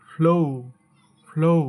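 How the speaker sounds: "flow" as a strong "floh-oo"; correct pronunciation. Shown as a demonstration. Teacher modelling the correct word, "flow" with emphatic o-u glide. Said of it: "এটা সঠিক উচ্চারণ হবে".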